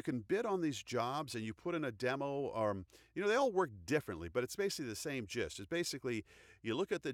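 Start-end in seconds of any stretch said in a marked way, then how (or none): none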